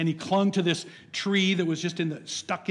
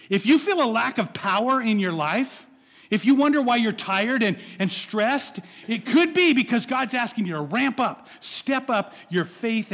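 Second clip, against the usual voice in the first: fervent preaching; 5 semitones higher, 6 dB louder than usual. Acted. Preaching at full force.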